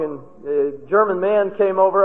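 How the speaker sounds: ordinary talk, nothing marked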